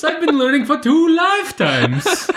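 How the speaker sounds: very high-pitched cartoon Italian accent; ridiculous Italian accent. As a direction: squeal it out in silly mock Italian. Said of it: "with a ridiculous Italian accent".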